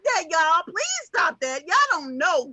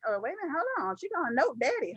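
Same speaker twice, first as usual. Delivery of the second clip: ordinary delivery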